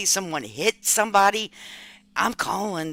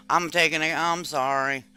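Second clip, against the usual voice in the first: usual speaking voice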